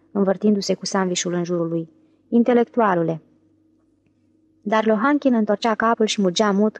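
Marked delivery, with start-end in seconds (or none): none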